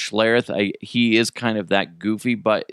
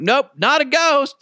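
higher, deadpan discovery; high-pitched retold realization; mock realization; 13 semitones higher, 3 dB louder than usual. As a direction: announce the gross truth. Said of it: "I'm like".

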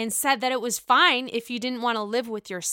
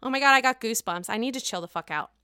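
usual speaking voice

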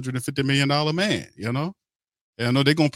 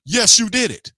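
louder and higher, hammering it home; emphatic insistence; 8 semitones higher, 5 dB louder than usual. Real, not acted. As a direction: shout it back, no argument. Insisting Derek Carr did it.

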